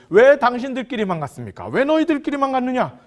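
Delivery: loud, high-pitched acted confrontation; indignant challenge. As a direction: demand an explanation, voice pushed up. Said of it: "이걸 따지는 겁니다". (따져 묻듯 높고 크게)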